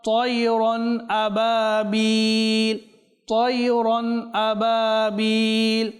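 slow, melodic tajweed chant; slow chanted Quranic recitation. Shown as a demonstration. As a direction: draw out each Arabic syllable in measured recitation. Melodic recitation of Surah Fil.

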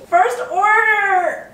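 very high, drawn-out squeal; ecstatic cheer. Real, not acted. Founder getting the first order, ecstatic. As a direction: stretch it into a high, elated cry.

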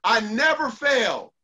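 slow and deliberate, each word weighted; slow affirmation. Shown as a demonstration. Coach modelling the affirmation slowly for listeners. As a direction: model it so they can repeat it. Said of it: "I wanna see you say it".

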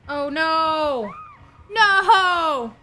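rising alarmed shout of no; panicked cry. Real, not acted. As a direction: yell it as the killer closes in. Killer spots her.

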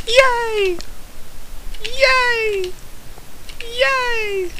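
tiny high-pitched mock cheer; imitated cheering. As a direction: squeak out a soft, drawn-out mock cheer. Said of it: "He's, like, cheering, cheering my death".

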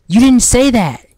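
loud, high-pitched disbelief; indignant protest. Real, not acted. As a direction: call out the customer's lie. Indignant at a customer's order.

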